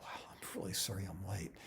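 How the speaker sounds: whispered wow into a low apology; hushed, low-pitched apology; self-talk lead-in. Shown as a demonstration. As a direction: breathe out the opening, very soft. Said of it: "give me a reason to say the first words".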